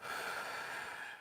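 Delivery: long unvoiced out-breath; deep exhale. Real, not acted. Exhaling deeply while guiding viewers to breathe with her. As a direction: breathe out slowly and audibly. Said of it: "breathe with me".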